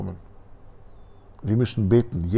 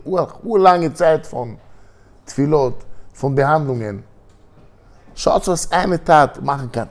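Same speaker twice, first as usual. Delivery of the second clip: louder, higher, slow emphatic preaching; preaching at full force; 7 semitones higher, 9 dB louder, slower than usual. Acted. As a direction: drive home the lesson to the audience.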